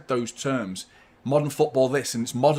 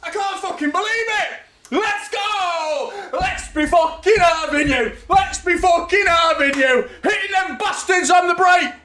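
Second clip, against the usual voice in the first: high, loud roaring joy; wild celebration; 6 dB louder, slower than usual. Real, not acted. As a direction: yell it at the top of your lungs. Celebrating the City goal.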